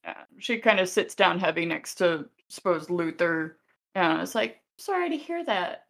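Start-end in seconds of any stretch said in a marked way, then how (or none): 4.8-5.8 high, soft sympathy with a touch of sadness; a little sad. Acted. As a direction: offer condolences gently, pitched well up. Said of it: "just kinda gets a little sad".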